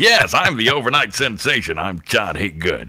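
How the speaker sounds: hammed-up DJ impression; Todd Hager. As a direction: proclaim it like a radio star. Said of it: "He would start talking like that".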